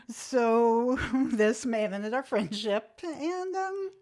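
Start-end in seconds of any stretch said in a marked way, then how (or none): 3.0-3.9 high-pitched, put-on goodbye; mock farewell. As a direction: feign a polite end to the friendship.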